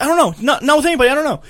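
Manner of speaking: high-pitched mimicked protest; panicked denial. Chris denying he knows anything, panicked. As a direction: blurt it out high, dodging the question. Retold friend dodging an angry interrogation.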